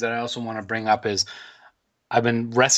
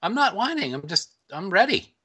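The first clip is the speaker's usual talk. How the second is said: high-pitched whiny protest; whining; 9 semitones higher than usual. Real, not acted. Host denying he is stalling before recording, whining. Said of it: "quit whining".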